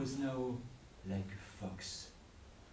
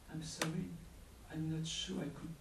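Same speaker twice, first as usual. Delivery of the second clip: put-on timid young man; 8 semitones higher, 5 dB quieter, faster than usual. Young man's timid reply.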